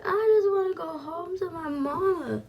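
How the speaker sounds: dramatized tearful wail; retold crying. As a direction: plead like a crying child, hammed up. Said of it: "I'm crying".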